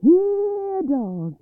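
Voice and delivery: sudden high, loud call; hollering. Acted. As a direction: shout for the dogs across the swamp. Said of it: "he hollered".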